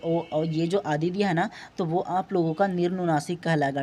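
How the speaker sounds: ordinary delivery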